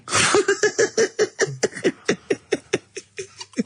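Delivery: long high helpless laugh; high squealing laugh. Real, not acted. Co-host cracking up at the winner's name.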